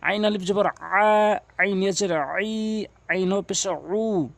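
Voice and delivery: final "আ", "আই", "উ" drawn long with throat pressure; letter names, then a long pharyngeal "আ", "আই", "উ"; ayn spelling drill. Shown as a demonstration. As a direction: spell it out, squeezing "আ", "আই", "উ" from mid-throat. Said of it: "হলকের মাঝখান থেকে চাপ দিয়ে উচ্চারণ".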